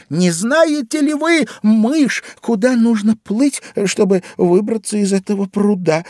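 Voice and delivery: put-on high-pitched polite question of a little girl; Алиса обращается к мыши. A narrator's reading. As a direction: address the mouse in a high, careful child's voice. (высоким голосом вежливо спрашивает мышь)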